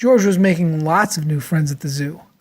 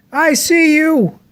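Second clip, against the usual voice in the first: higher, slow, teasing zookeeper; a gotcha from the zookeeper who was waiting; playful zookeeper; 10 semitones higher, slower than usual. A narrator's reading. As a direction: catch him out with a slow sing-song. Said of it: "he said".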